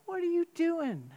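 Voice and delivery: sharp, high self-scolding burst; scolding himself. Retold or imitated. Pastor scolding himself in traffic, sharp and high. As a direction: snap at yourself for losing your temper. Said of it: "I thought".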